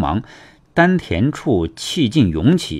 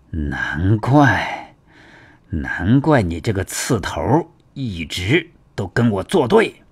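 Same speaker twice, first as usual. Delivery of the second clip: murmuring; 2 semitones lower, slower than usual. A narrator's reading. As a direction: begin as if to yourself, rise into resentment. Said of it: "喃喃地说道".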